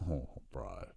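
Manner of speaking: low, soft groan of dismay. Retold or imitated. Fan finding all the jam donuts burnt, dismayed. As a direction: let it sink, quiet and defeated.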